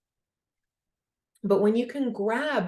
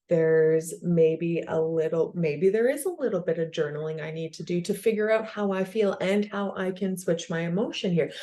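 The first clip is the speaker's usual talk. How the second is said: normal talking voice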